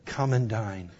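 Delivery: soft hushed invitation; whisper. Acted. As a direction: invite them gently to eat. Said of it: "a whisper that went out".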